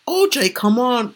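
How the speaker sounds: high, loud, slow mock plea; pleading. Charlie begging O J to walk away, high slow pleading.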